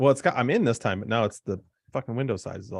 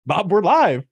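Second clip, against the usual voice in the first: loud live announcer; 12 semitones higher, 11 dB louder than usual. Pretend. Mock live broadcast announcement.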